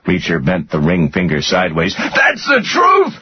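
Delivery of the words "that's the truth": very high, drawn-out pained scream. A narrator's reading. Rudder as his finger is bent sideways, screaming. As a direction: scream it desperately, high and slow. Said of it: "Rudder screamed".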